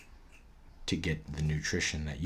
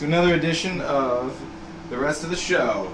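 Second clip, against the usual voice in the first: higher, louder announcer read; show host voice; 8 semitones higher, 7 dB louder than usual. Acted. Hosting the show.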